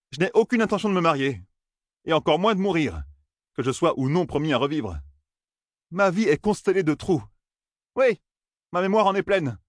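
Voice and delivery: put-on frustrated young man. A narrator's reading. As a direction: push the questions like an impatient youth.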